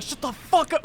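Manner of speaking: high, sudden yelled outburst; unhinged yell. Retold or imitated. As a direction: snap and yell at a troll. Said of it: "just went completely unhinged".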